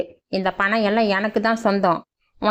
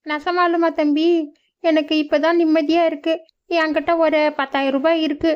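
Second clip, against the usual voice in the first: put-on higher old mother's voice, relieved; 6 semitones higher than usual. Old mother thanking the young man, higher and relieved. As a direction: gratefully press the money on him.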